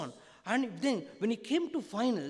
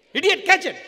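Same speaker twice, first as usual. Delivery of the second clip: full shout, much higher and louder; shouted insult; 11 semitones higher, 14 dB louder than usual. Shown as a demonstration. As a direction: yell it like you mean it this time. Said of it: "Come on, of you".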